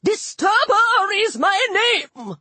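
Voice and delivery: high, drawn-out furious cry; enraged, affronted god; angry shout. A narrator's reading. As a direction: proclaim your name in high-pitched fury. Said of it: "the god shouted angrily".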